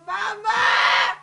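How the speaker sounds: loud, drawn-out call for mother; anguished cry. Real, not acted. Daughter calling to her dead mother, anguished cry. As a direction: cry out in grief.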